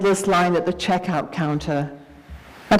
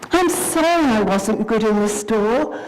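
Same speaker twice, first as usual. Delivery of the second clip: put-on higher, quick childlike apology; a small girl rushing to make it right; little girl begging mummy to stop crying; pleading little girl; 8 semitones higher than usual. Child comforts crying mother.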